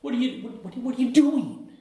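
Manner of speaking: high, startled Peter; Peter's alarm. Retold or imitated. Peter startled as Jesus washes his feet, high. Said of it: "and he goes".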